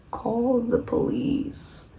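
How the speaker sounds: high, soft and drawn out; slow, hushed advice. Retold or imitated. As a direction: stretch it out slowly and softly, retelling the advice. David's drawn-out advice on the phone.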